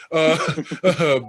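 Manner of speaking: can't hold back a laugh. Real, not acted. Host reading award names, cracking up laughing. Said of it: "I can't even say this without laughing".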